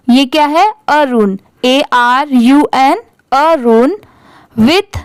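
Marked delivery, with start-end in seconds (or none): none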